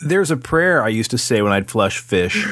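ordinary talk

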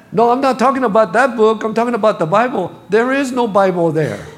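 hurried, insistent objection in a retold joke; mock protest. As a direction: act out a wife's loud correction. Wife's exasperated protest in joke.

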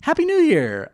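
high, loud cheerful shout; excited greeting. Real, not acted. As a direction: burst out with the greeting. Greeting co-host at the start.

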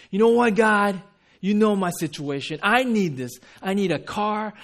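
insistent list, climbing to high, loud impatience; exasperated, entitled complaint to God; demanding. Acted. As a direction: demand it as if owed. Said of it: "those who demand God to move".

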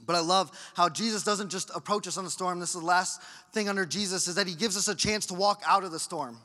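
ordinary talk

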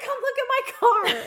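high, loud, laughing plea; high pleading coworker. Retold or imitated. Employee begging his boss to see the car, high and loud. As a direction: beg her to come outside and see. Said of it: "He's like".

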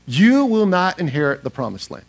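usual speaking voice

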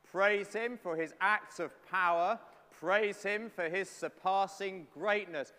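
slow, measured call to praise; psalm recitation. Acted. Preacher leading the congregation through Psalm 150, slow and declaiming. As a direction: recite each line slowly for the room to follow.

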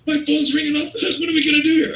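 squeaky high frantic voice of a flustered owner; panicked owner. Retold or imitated. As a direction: play the boss losing his mind in a shrill squeal. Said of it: "I'm fuming right now".

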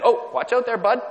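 high, loud, breezy warning to a stranger; cheery warning. Retold or imitated. Woman in the corridor, a high, loud cheery warning. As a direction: sing out a friendly caution. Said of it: "she said".

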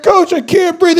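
high, loud out-of-breath plea; gasping kid. Retold or imitated. Exhausted player pleading with coach, loud. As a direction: beg the coach for air. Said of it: "And I'm like".

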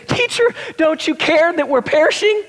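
high, pleading cry to a sleeping teacher; desperate cry. Retold or imitated. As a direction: cry out in alarm, waking him. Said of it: "they wake him up and they say".

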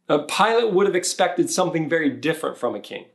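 ordinary delivery

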